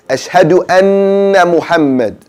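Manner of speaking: anna said with a held N; held N correct. Shown as a demonstration. Teacher shows the proper Ashhadu anna. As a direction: hum the N in anna. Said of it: "proper way to say it".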